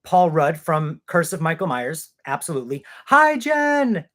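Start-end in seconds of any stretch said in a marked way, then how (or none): 3.1-4.0 high, loud happy call-out; excited greeting. Real, not acted. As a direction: call out to a friend you've just spotted. Greets a viewer in chat.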